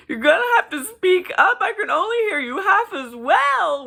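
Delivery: very high, very loud shriek; imitated shouting. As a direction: yell shrilly as if half deaf. Imitates Jinx shouting with ear missing.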